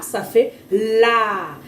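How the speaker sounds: very slow, drawn-out la; la sounded out slowly; slow syllable demo. Shown as a demonstration. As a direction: stretch ça fait la for a child. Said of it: "Le A".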